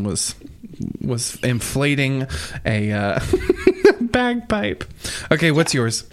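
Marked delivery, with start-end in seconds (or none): 3.2-4.0 laugh. Real, not acted. Laughs over bagpipe story.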